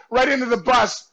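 ordinary delivery

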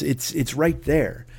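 usual speaking voice

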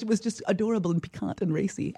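ordinary talk, nothing marked

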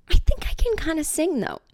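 high hopeful inner voice; hopeful thought. Retold or imitated. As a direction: dream of being discovered. Thinking to herself.

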